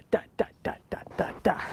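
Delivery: soft breathy whispered da da da. Retold or imitated. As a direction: whisper the little da-da-da sound softly. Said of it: "You can hear the da".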